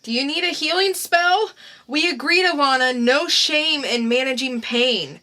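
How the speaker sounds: high, loud and urgent; anxious [name]. Acted. [name] offers Alana a healing spell, loud and worried. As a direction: rush to offer help, worried. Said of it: "[name] doing the characters".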